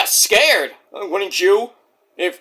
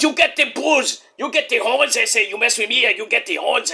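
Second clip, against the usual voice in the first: put-on Mexican accent, pitched up; mock Mexican accent; 3 semitones higher than usual. Host imitating wrestler's threat, mock Mexican accent. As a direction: taunt like a hammed-up Mexican wrestler. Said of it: "Then he says something".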